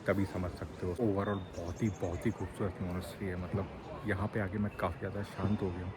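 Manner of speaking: hushed, soft vlog commentary; speaking quietly. Real, not acted. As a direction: keep your voice down in a silent monastery. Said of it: "यहाँ पे धीरे बोल रहा हूँ".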